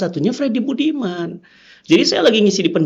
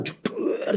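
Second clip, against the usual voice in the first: voiceless burst for a gun going off; imitated gunshot; 12 dB quieter than usual. Preacher retells the firing squad shooting, soft imitated bang. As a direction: spit out a soft gunshot noise.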